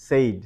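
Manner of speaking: "said" said loudly as "sayd", long "ay"; mispronounced. Shown as a demonstration. Teacher voicing what many think it is, mispronounced. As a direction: punch out "said" to rhyme with "made". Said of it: "but that's not the case".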